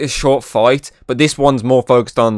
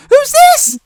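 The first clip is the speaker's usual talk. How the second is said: very high squeaky voice, louder; 8 dB louder than usual. Retold or imitated. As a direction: squeak it like a voice breaking. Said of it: "in that really, like, squeaky ass voice".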